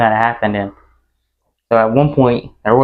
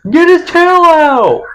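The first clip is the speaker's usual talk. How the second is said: high, loud, slow desperate shout; panicked yell; 6 dB louder, much slower than usual. Retold or imitated. Calling to Michael to free the dog's tail, high panicked yell. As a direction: scream for help in a panic.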